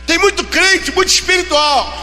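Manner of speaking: loud, high fervent preaching; preaching at full force. Acted. Pastor exhorting the unemployed, preaching loudly.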